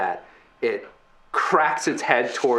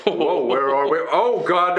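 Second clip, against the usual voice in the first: Crux; 3 semitones higher than usual. Acted. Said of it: "Crux is like".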